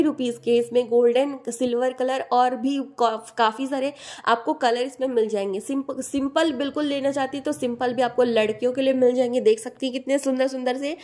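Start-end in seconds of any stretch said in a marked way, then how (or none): none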